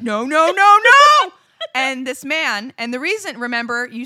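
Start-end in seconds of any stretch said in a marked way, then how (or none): 0.0-1.3 mock-panicked no's building to a yell; retold panicked protest. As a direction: rise higher and louder with each no. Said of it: "I was like".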